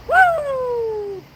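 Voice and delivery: high, loud little cheer; excited whoop. Real, not acted. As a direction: whoop with delight. Cheering for a viewer.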